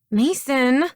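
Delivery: high, loud call of his name. A narrator's reading. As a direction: call across to him. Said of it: "Addison called".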